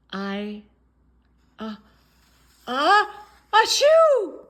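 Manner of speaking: halting words then a loud high sneeze; about to sneeze. Acted. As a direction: build the gasp into a sneeze. Gerald sneezing again.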